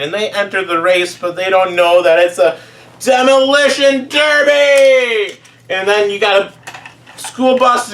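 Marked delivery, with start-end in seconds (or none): 3.0-5.4 higher, louder, slow dramatic shout; excited shout. Retold or imitated. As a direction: announce the reveal like a big twist. Big reveal of the race.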